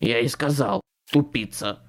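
understated irritated grunt; mildly gruff, annoyed retort; grumbling. A narrator's reading. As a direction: grumble it crossly. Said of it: "буркнул Туз".

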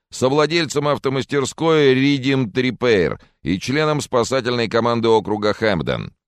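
high, slowed announcement of a guest's titles; TV field reporter. Acted. Reporter introduces the mechanic to viewers, high announcing voice.

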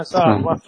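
سارة said as Saarrah, long r; doubled r. Shown as a demonstration. Sheikh insisting on the name, سارة with doubled r. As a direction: stretch the "rr" in سارة. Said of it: "بتشديد الراء".

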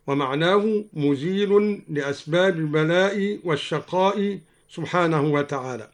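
normal talking voice

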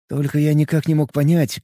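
usual speaking voice

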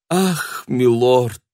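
high theatrical cry; exclaiming. Acted. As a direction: gasp it out dramatically. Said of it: "воскликнула она".

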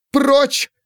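sharp high-pitched outcry; angry command. A narrator's reading. Pandora shoving the servant away, angry command. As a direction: fling it out to drive him away.

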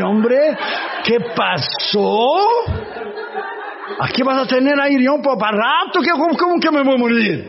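chuckling in disbelief; laughing dismissal. Retold or imitated. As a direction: brush off the worry with a laugh. Laughs off friend's fear of death.

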